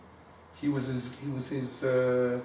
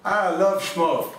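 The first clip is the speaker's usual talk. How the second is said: loud, higher dismissive scoff; scoffing rebbe; 6 semitones higher, 6 dB louder than usual. Retold or imitated. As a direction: scoff at love like a skeptical rebbe. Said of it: "He goes".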